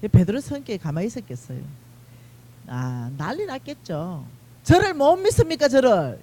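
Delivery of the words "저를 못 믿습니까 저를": high, loud indignant outburst; indignant Peter. Acted. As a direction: protest like the hot-tempered Peter, loud and high. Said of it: "이러고 난리 났겠죠". (발끈한 베드로처럼 높고 크게 외치기)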